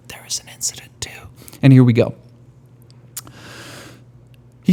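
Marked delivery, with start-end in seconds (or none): 0.1-1.1 hushed whisper, quick and secret. Acted. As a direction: drop to a whisper like telling a secret. Dramatic reveal of second incident.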